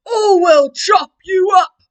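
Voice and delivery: high, loud, slow threatening shout; bellowed. Acted. Woodcutter threatening the wolf, loud slow bellow. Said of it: "bellowed the woodcutter".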